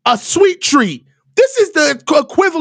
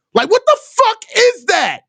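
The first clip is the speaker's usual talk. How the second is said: ordinary talk, nothing marked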